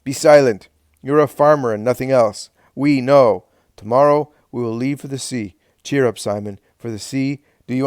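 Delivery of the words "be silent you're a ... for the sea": angry order. A narrator's reading. Helkai silences Daniel.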